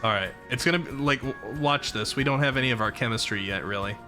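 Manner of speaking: normal talking voice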